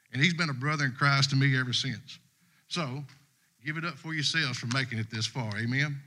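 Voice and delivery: normal talking voice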